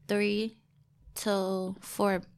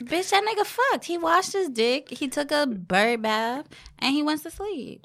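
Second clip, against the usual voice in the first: excited retelling; 6 semitones higher than usual. Retold or imitated. Retelling her shocked text reply.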